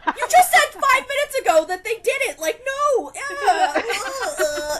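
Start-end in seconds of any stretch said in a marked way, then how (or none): 0.1-3.1 mock exasperated protest. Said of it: "and I'm like".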